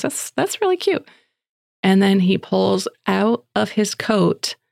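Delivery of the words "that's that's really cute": gushing praise. Retold or imitated. Mom praising gift.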